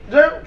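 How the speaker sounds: loud, high "Jail" with a rising tune; emphatic punchline. Real, not acted. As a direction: punch the single word "Jail" as the payoff.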